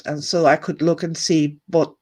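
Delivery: ordinary delivery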